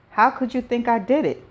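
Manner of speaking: pitched high, demanding why; hurt, pleading question. A narrator's reading. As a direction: ask it as if betrayed. Hurt husband demands an answer.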